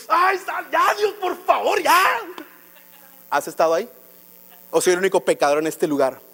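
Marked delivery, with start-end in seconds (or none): 0.1-2.2 súplica aguda y quejumbrosa, exagerada; desesperación actuada. Retold or imitated. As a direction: ruega a Dios como si ya no aguantaras. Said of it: "me empiezo a desesperar". (ruego desesperado, teatral y agudo)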